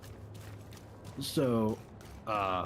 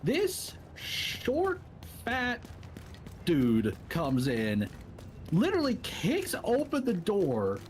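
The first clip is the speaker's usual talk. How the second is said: ordinary talk, nothing marked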